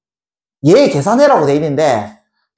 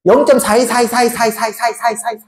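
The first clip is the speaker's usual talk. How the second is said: rapid repeats trailing away to nothing; 끝없이 반복해 읽기. Shown as a demonstration. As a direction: read the repeating decimal endlessly, fading out. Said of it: "이렇게 하나?". (빠르게 반복하며 점점 작아지게)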